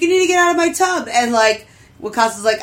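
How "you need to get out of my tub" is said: high, rushed mock panic; retold freak-out, quick and pitched way up. Man finding a dude in his bath, mock freaking out. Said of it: "So he, like, freaks out".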